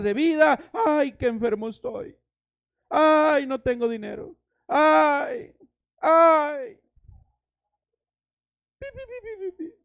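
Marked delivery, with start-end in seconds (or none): 0.8-6.8 higher, louder mock whine of "ay"; mock whining. 8.8-9.7 very high, soft censor beeps "pi, pi"; imitated beeps. Bleeping out complaints.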